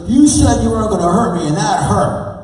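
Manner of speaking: high, loud, whiny accusation; outraged teen girl. Retold or imitated. Teen patient accusing the doctor after the adjustment, high loud whine. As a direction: wail it like a betrayed teenager.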